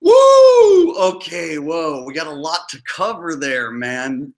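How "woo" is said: high, loud whoop; loud woo. Real, not acted. Reacting to the impression of himself, loud high whoop. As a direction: let out a long high woo. Reaction to the clip just played.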